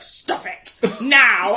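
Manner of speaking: loud, slow imitation of stern mum; stern mum's drawn-out order; mock telling-off. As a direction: scold slowly and loudly, half laughing.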